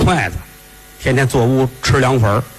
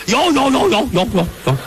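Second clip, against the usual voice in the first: higher, fawning yes-yes-yes; eager madam; 9 semitones higher than usual. Acted. Brothel madam eagerly agreeing, fawning and higher. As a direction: rattle off the yeses like a greedy madam.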